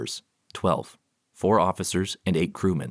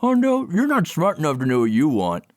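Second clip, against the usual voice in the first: mouth full; 11 semitones higher, 5 dB louder than usual. Acted. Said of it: "his mouth full of food".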